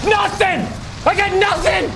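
furious rant. Real, not acted. Gamer complains the game gives him no information, shouting rant. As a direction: yell every line, fed up. Ranting about missing game info.

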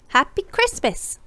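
put-on high, slow, sing-song little girl voice; a cheeky little miss, drawn out; contrary girl.

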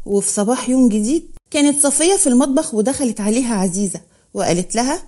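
ordinary delivery

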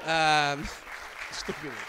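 normal talking voice

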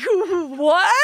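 high, loud "What?"; floored by the news; squeaky stunned "What?"; shocked disbelief. Retold or imitated. Hearing Mario Lemieux wants to meet.

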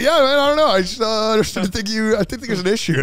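high, loud mock American dude; American accent. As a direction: imitate a carefree American, laughing. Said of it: "the most American-ass accent".

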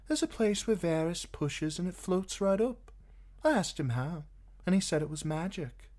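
put-on high, light young woman's voice; Shay's voice. A narrator's reading. Shay chattering to Tyrion in bed, high and eager.

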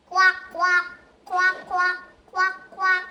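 loud quacking like a duck; loud duck quacks. Shown as a demonstration. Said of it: "Ikalawa ay bibe".

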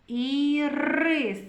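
ірис with a long rolled р; р drawn out. Shown as a demonstration. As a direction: hold and roll the р in ірис. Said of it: "Р твердий приголосний".

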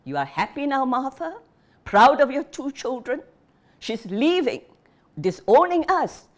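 a father disowning his daughter in fury; shouting in rage. Acted. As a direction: yell it through the house. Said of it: "shouting into the hot afternoon air".

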